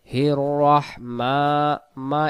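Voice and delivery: রহমা chanted with a long held "maa"; one-alif stretch. Shown as a demonstration. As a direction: draw out the মা of রহমা slowly. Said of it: "এক আলিফ".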